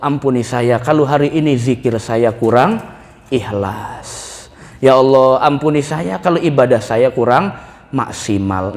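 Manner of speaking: low, subdued plea for forgiveness; humble prayer. Acted. Preacher models asking God's forgiveness, humble low prayer. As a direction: pray it low, softening at each phrase end.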